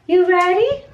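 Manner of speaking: high-pitched coaxing question. Real, not acted. Mom asking son before his bath, baby talk. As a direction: lift it up sweetly for a little one.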